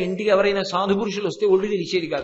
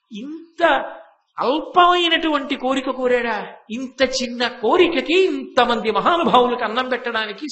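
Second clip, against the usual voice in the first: marveling rhetorical question; 5 semitones higher than usual. Acted. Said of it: "ఆయన అన్నాడు".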